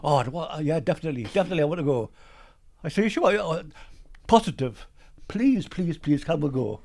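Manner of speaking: imitating an eager wife begging please; mock eager wife. Husband retells wife begging to move south, eager high voice.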